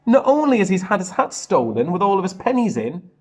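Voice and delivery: ordinary delivery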